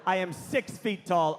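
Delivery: ordinary talk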